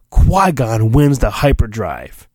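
ordinary talk

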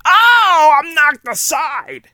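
high mock cry of a knocked-down knight; playful falsetto yelp; hammed-up Obi-Wan. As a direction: squeal like you got shoved.

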